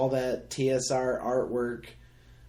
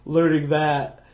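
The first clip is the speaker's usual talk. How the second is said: talking through a big yawn; words stretched by a yawn; 8 semitones higher, 8 dB louder than usual. Real, not acted. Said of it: "Sorry, I'm yawning".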